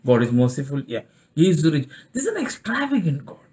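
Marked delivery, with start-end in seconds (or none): none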